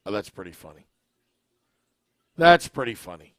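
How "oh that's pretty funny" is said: ordinary talk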